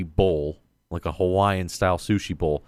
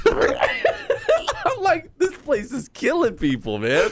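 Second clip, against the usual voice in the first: laughing; much slower than usual. Retold or imitated. Laughing quote.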